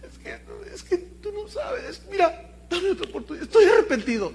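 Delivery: high, whiny fake sobbing; mock crying. Cheating husband fake-crying to his wife, high and whiny. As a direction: blubber through the excuse, fake tears. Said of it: "Y empieza a llorar".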